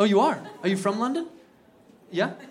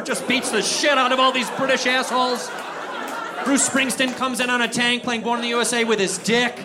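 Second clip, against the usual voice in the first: loud, raised patriotic rant; worked-up, higher and louder narration; fired-up shouting; 6 semitones higher, 4 dB louder than usual. Acted. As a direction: yell it as a triumphant fantasy. Shouted patriotic revenge fantasy.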